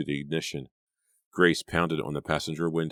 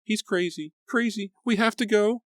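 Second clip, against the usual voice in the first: whimpering with fear. A narrator's reading. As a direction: urge her, near whimpering. Grace in panic.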